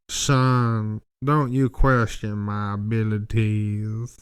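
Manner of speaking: slow Southern scolding; a lightly done Southern gentleman taking offence; vampire guy warns the narrator, mock Southern; mock Southern lawyer. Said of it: "he talked like a, a fucking Southern lawyer".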